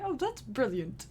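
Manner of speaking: softly put-on British accent; amateur imitation of a British accent; bad British accent. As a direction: quote it in a light, poor British accent. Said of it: "Just my bad accent".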